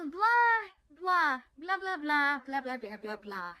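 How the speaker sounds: high loud blah blah, fading to mumbled. Shown as a demonstration. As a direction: belt out the blahs then let them fade. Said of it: "it's kind of like the filler word".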